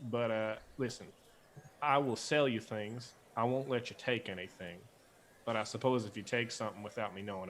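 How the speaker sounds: folksy Southern clerk musing; Southern accent. Acted. Georgia keeps talking.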